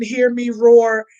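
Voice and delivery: ordinary talk, nothing marked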